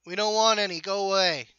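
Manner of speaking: loud, high voice shouting through a door; gruff resident yelling to go away; muffled voice through door. Acted. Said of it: "a muffled voice from the inside".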